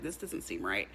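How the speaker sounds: low, quiet doubt to herself; doubting aside. Retold or imitated. The wife at the door doubting the welcome, low and quiet. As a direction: mutter the doubt under your breath. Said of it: "she's like".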